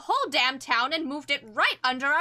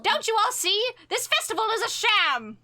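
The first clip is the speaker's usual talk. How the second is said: urgent shouting; 7 semitones higher than usual. Acted. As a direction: warn the whole crowd, pitched up. Eleanor warns the crowd urgently.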